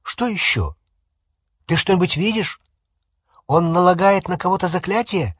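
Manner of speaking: urgent, high-pitched questions; loud questioning. A narrator's reading. Ron pressing Harry about his vision, loud and urgent. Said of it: "громко сказал Рон".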